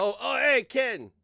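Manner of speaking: high, loud holler to get attention; calling out. Pretend. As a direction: yell for someone across the room. Calling out to Ken.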